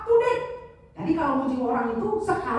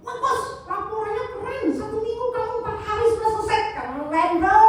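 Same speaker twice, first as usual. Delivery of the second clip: enthusiastic; 7 semitones higher than usual. Shown as a demonstration. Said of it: "harus antusias ketika memuji".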